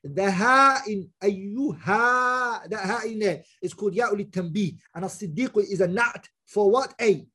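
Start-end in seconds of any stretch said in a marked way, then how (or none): none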